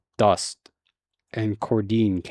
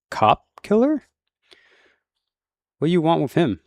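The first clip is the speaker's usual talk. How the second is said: much higher, sharp alarmed question; shocked disbelief; 7 semitones higher than usual. A narrator's reading. Deputy reacting to the cop killer, shocked and much higher. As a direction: recoil at the name.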